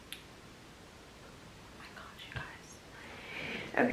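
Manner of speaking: slow, overcome whisper; emotional whisper. Real, not acted. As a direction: breathe it out, too moved to speak aloud. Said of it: "Why am I so emotional?".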